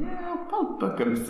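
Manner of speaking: high-pitched mock objection; squeaky-high imitation of indignant reaction; mock protest. Acts out Quebec viewers' reaction.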